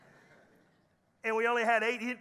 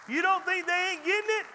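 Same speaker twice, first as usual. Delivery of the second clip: higher, loud preaching call; 8 semitones higher, 5 dB louder than usual. Real, not acted. Pastor challenging his congregation loudly. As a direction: challenge the crowd and wait for amen.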